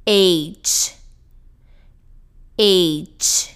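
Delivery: "H" as aitch with a crisp ch ending; clear final ch. Shown as a demonstration. Teacher drilling letter H, clear ch at the end. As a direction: say "H" as "eitch", releasing the ch fully. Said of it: "Presta atenção no finalzinho".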